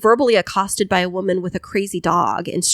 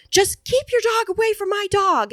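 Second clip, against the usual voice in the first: imitating angry lady; 12 semitones higher than usual. Retold lady snapping at couple.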